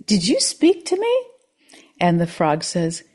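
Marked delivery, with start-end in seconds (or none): 0.1-1.2 high, surprised voice; embarrassed old man. Acted. Old man answering a frog on the street. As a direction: ask it a bit startled.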